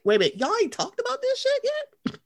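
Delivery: shocked high question at the housewives; incredulous. Retold or imitated. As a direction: squeal it like you can't believe it. Incredulous reaction to cast.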